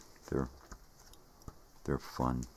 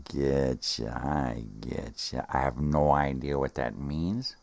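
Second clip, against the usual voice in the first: usual speaking voice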